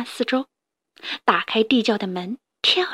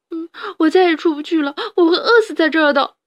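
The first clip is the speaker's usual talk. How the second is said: wailing; 5 semitones higher, 4 dB louder, faster than usual. Acted. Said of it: "哈布斯大哭了起来".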